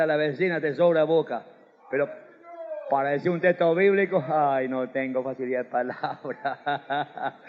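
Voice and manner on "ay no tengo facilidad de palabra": low, soft laughing mock whine; mocking laughter. Preacher laughing while mimicking a churchgoer's excuse.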